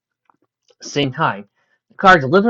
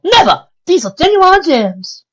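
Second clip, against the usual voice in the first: indignant; 10 dB louder, slower than usual. Acted. Refusing the mugger, indignant.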